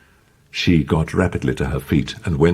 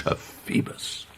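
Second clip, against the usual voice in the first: hushed, longing whisper; 11 dB quieter, slower than usual. A narrator's reading. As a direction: breathe the name softly.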